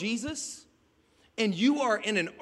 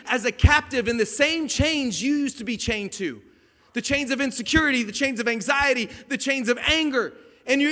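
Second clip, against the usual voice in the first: steady, urgent sermon delivery; impassioned preaching; 5 semitones higher, 5 dB louder than usual. Acted. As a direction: press each line on the listeners. Sustained preaching.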